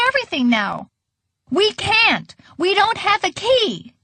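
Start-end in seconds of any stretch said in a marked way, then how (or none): 1.5-3.9 screaming back. A narrator's reading. Waitress to Grandma about locked door, screaming back. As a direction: throw it back in a mild scream. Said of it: "screamed the waitress back".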